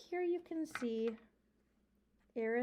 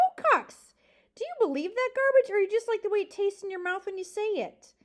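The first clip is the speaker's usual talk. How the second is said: high, loud taunt; confronting; 9 semitones higher, 10 dB louder than usual. A narrator's reading. Porcupine confronting the owl, loud high taunt.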